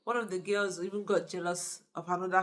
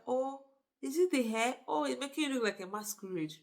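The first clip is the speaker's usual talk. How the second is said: higher-pitched mock surprise at the sight; hammed-up, raised-pitch teasing; mock reaction; 6 semitones higher than usual. Husband finally noticing his wife's new hair, high mock reaction.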